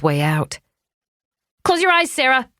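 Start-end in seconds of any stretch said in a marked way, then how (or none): 1.6-2.5 sharp command. A narrator's reading. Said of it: "she said sharply".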